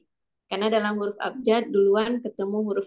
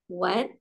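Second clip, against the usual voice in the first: what rising like a question; second tone; 2 semitones lower, 3 dB quieter than usual. Shown as a demonstration. Tone mnemonic for students, second tone. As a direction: lift the pitch through what. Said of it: "nada dua".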